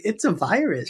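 high-pitched mocking imitation. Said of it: "[name]. She's like".

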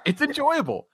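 high squeaky mocking repeat. Mocking repeat of own line.

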